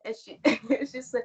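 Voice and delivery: normal talking voice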